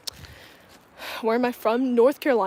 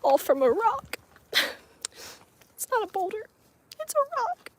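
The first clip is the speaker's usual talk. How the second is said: high put-on voice, rising sharply toward the end; quoted catchphrase voice; 12 semitones higher than usual. Vlogger quotes a reference to viewers in a high, put-on voice. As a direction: playfully act out a quote, pitched way up. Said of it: "If you guys know that reference".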